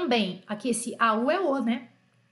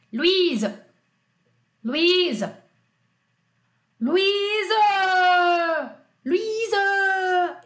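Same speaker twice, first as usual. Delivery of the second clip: high, raised French call, last vowel drawn out; French mother calling; 7 semitones higher, 5 dB louder, much slower than usual. Acted. French mother calling her daughter Luísa, insistent high call. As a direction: call it higher each time, dragging the French mute ending. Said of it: "Agora olha a mãe francesa".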